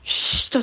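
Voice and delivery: long sharp shush; hushing shush. Acted. Son hushes mother over the resting chicken, hushing. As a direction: shush someone to keep them quiet.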